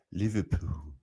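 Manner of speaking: Liverpool said with a light Scouse lilt; attempted Liverpool accent. Shown as a demonstration. Trying a Liverpool accent on the word Liverpool, lightly. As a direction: try Liverpool in a Scouse accent, half-hearted. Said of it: "I can't even do that Liverpool accent".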